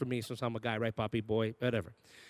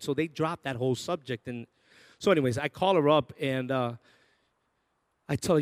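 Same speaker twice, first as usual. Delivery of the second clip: ordinary delivery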